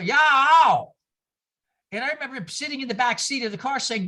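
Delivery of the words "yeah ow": loud Southern drawl, shouted; Southern accent. Retold or imitated. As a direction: call out like a country gas attendant. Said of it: "he said to my mother".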